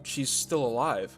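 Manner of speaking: plain reading voice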